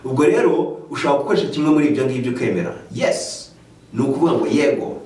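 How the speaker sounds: ordinary delivery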